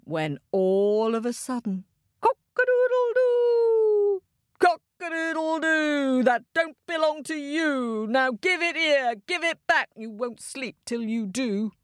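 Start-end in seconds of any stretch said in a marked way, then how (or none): 2.2-6.2 very high, drawn-out rooster crow; shrieking cockcrow, long and high. A narrator's reading. Rooster crows to wake the king. 6.2-11.7 high rooster voice, demanding; insistent high-pitched rooster, falling at the end; scolding rooster. A narrator's reading. Rooster demands the coin back.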